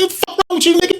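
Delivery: a high, hammed-up angry yell; mock angry yell. Host acting out the co-host yelling at the driver, mock angry yell. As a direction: ham up the road-rage outburst.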